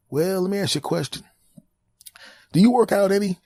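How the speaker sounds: high, chirpy doctor voice; high cheerful doctor asking questions; high mock doctor. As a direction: breezily quiz the caller.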